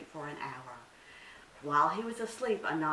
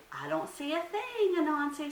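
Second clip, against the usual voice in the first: much higher, louder innocent little deer; Little Bush Deer; 11 semitones higher, 5 dB louder than usual. Acted. Little deer plays innocent to trick Anansi, high and sweet. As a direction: act innocent, a small high voice. Deer keeps playing dumb.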